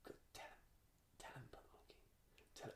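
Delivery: urgent whispered prompting; voiceless, secretive egging-on; little inner voice. Retold or imitated. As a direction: whisper it like a nagging thought in the head. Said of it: "there's a little voice".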